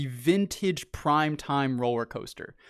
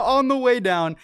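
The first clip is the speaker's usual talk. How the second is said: normal talking voice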